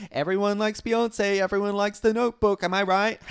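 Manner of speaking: high, gushing mockery of women; mock ladies' voice. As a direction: imitate chatty ladies, ending in a fake giggle. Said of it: "you ladies all seem to think".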